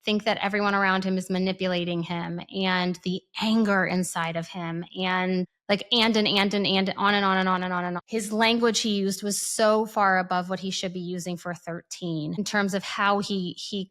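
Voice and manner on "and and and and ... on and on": fast rattled-off and and and; rapid mock list. Mocking endless list.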